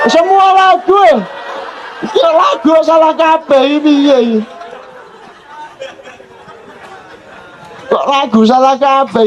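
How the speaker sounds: hammed-up child complaint; complaining child. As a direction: whine as the child about every song. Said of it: "Anakku iki ngeluh".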